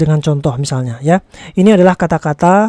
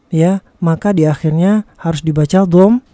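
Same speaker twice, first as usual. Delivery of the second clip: ordinary talk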